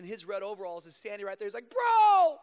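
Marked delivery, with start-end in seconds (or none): 1.7-2.4 high, loud, slow relieved yell; excited high shout. Retold or imitated. Friend in red overalls shouts his confession, overjoyed. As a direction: blurt it out with joy.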